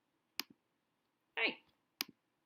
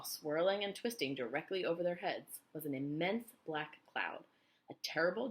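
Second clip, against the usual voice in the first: ordinary delivery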